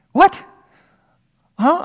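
sudden loud, high disbelief; shocked what. Acted. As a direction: blurt it out in total shock. Reacting to God saying too many.

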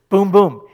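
two loud punched booms; emphatic boom boom. Shown as a demonstration. Preacher beating out Peter's emphasis, loud booms. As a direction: hit each boom like a drum. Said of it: "he does a".

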